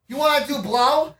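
high, loud offer; hammed-up stranger's question; imitated eager stranger. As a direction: pitch it up like a pushy stranger.

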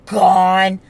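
usual speaking voice

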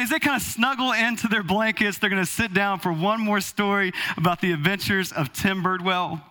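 ordinary talk

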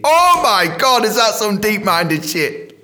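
loud, high, drawn-out astonishment; bowl yourself over with your own cleverness; mock amazement. Dentist showing off the muscle's name, loud mock amazement.

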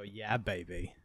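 low, soft imitation of the catchphrase; Austin Powers. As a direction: slip quietly into the spy character. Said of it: "getting into character for Austin Powers".

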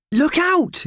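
high sharp warning yell. Acted. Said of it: "yelled Purvis".

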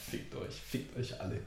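breathy whisper, almost silent. Pretend. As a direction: whisper it with smug contentment. Beim Hinlegen ins frische Bett. (geflüstert)

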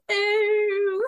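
high, held-out mock exclamation; exaggerated high "oh"; mock Bible-man voice. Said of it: "anytime there's a man talking in the Bible".

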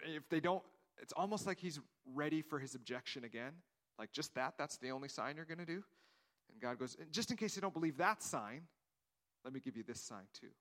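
ordinary delivery

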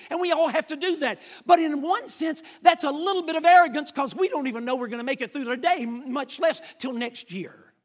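fervent preaching. Acted. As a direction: build the sermon with rising fervor. Preaching builds again.

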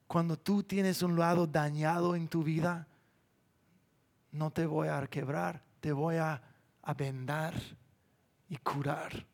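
very soft, low, gentle reassurance; God speaking tenderly. Acted. God reassuring a damaged person he will heal them, hushed and tender. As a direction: drop right down, soothing, with long pauses. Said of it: "Y Dios dice".

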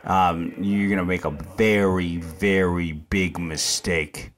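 low, measured parental warning; old-country stern parent. Acted. Parent warning a child who talks back, low and stern. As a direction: lay down the law, pitch dropped, slowing at the end. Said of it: "I went old country on him".

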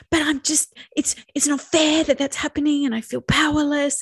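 high, exasperated pleading; upset protest. Pretend. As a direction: protest as if nobody will listen. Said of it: "I'm yelling and getting upset".

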